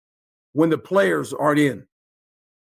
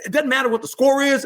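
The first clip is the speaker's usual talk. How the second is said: normal talking voice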